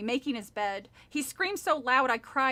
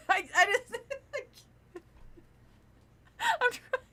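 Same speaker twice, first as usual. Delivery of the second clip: laughing high, nearly crying; helpless laughter; 10 semitones higher than usual. Real, not acted. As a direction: struggle through giggles.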